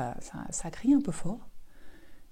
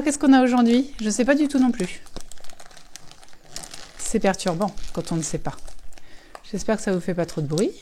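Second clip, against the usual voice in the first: ordinary delivery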